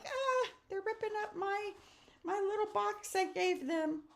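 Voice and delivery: squeaky high whining voice; high mock dismay. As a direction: play it as hurt and whiny. Imagined upset gift giver.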